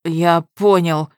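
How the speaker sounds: hoarse, forced-out answer. Acted. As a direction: squeeze it out hoarsely. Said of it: "хрипло выдавил гнида". (хрипло выдавливая)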